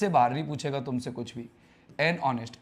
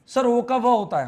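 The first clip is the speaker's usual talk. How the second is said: higher, louder surprise at ओ sounding व; amazed double-take; student surprised that ओ can sound like व; surprised question; 6 semitones higher, 6 dB louder than usual. Real, not acted. Student asks, teacher confirms.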